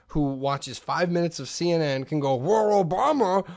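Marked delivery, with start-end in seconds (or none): 2.3-3.5 higher-pitched blustering pundit impression; hammed-up political rant, drawn out; Rush Limbaugh. Asshole with Cheetos ranting about Obama, blustering impression. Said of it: "go into your Rush Limbaugh".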